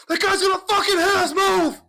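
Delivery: very high, loud, fast panicked scream. Retold or imitated. Passenger in the back warning about the semi, high panicked scream. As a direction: shriek it from the back seat in terror. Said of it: "the guy in the back's going".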